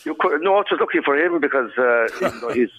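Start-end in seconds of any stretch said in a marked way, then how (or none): none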